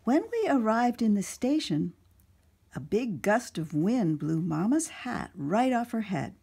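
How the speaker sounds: put-on animated children's story narration; storytime read-aloud. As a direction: read it out to a room of little kids. Reads picture book to children.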